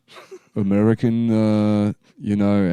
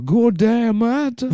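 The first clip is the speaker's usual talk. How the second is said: hammed-up mock accent, pitched way up; Australian African accent; 12 semitones higher than usual. A friend, clowning on request, doing his mock accent. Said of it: "Australian African".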